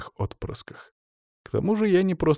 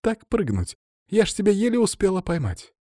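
put-on high, excited girl's voice; giggly teasing; 10 semitones higher than usual. A narrator's reading. Katya to Stas after catching him, giggly and amazed. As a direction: marvel at his jump, pitched well up. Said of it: "захихикала Катя".

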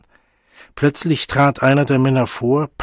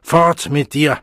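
laut gerufen, voller Verachtung; Schrei; 3 semitones higher, 6 dB louder, slower than usual. A narrator's reading. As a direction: die Männer brüllen den Jungen fort. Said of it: "schrien die Männer abermals". (Männer jagen den Jungen weg, lauter Schrei)

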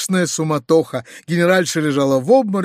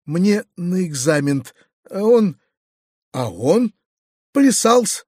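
halting, mildly tearful answer; plaintive; 2 semitones higher, slower than usual. A narrator's reading. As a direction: break off woefully, lifting on the last word. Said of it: "отвечал Гаврила плачевным голосом". (плачевным голосом)

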